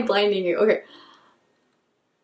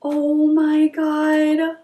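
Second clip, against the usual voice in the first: slow, drawn-out higher gasp of amazement; awestruck; 5 semitones higher, much slower than usual. Real, not acted. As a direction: draw it out in real awe. Overwhelmed by the palette.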